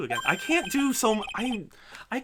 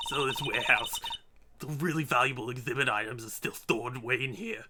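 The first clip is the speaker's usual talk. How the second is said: ordinary delivery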